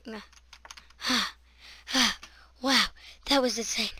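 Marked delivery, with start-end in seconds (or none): none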